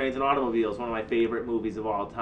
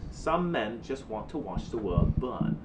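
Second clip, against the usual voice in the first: Michael Caine impression; 3 semitones lower, 4 dB quieter, slower than usual. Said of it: "was that a British accent?".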